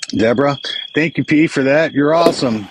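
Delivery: usual speaking voice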